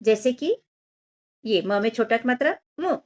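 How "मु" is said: मु with a very brief u; quick, short मु; short vowel. Shown as a demonstration. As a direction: say मु quickly and stop. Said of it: "छोटा ओ की मात्रा को जल्दी से बोला जा".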